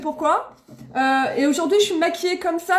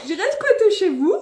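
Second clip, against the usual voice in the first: higher, through a real laugh; amused, laughing; 6 semitones higher, 3 dB louder than usual. Real, not acted. Streamer joking about crashing at viewers' homes, laughing. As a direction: repeat the joke while giggling. Laughing at her own joke.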